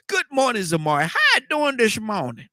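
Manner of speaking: high, lively "good morning" to the guest; bright greeting. Real, not acted. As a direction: greet her with a big lift. Host greets lady guest brightly.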